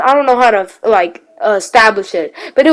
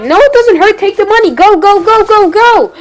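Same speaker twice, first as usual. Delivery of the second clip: higher, louder urgent push; insistent urging; 9 semitones higher, 3 dB louder than usual. Retold or imitated. Boy in the bushes urging his sister, higher and louder. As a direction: rush them on through the pain. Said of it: "I was like".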